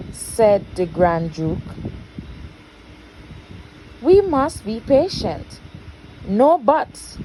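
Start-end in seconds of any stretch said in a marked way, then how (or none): 4.0-5.6 raised, urging patience; Grand Duke's caution. Acted. Grand Duke cautioning the impatient king, raised voice. 6.3-7.2 loud, high blustering king; king's shout. Acted. King shouting down the Grand Duke, loud and high. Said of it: "shouted the king".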